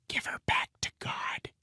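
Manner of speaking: hushed whisper. Acted. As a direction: breathe it, barely voiced. Peter whispers to Glenn.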